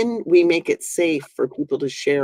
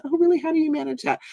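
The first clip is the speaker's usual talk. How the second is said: high-pitched, casually curious listener; interested reaction; much faster than usual. Acted. Acting a listener hearing about arthritis, high and curious. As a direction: act out a friend's airy, interested reply. Acted reply to someone's disclosure.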